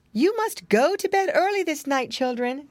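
a hint of a mother sending children to bed; Missus Twistytail. A narrator's reading. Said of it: "said Missus Twistytail after supper".

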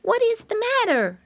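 put-on high, childlike piggy voice; a small high voice, offering help; little piglet. A narrator's reading. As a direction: pitch it up like a small, helpful piglet. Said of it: "asked Flop".

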